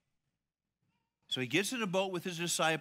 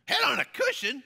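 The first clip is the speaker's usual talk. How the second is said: high, loud incredulous question; hammed-up amazement at the cushion; mock disbelief; 11 semitones higher, 10 dB louder than usual. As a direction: scoff at the detail. Mocking the cushion detail.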